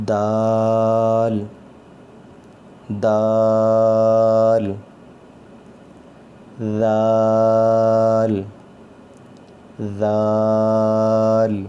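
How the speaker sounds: دال with a hard d and the vowel held very long; hard lengthened dal. Shown as a demonstration. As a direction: stretch دال, hit the d hard. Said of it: "اس کو مد کر کے پڑھنا ہے". (سخت اور لمبی دال)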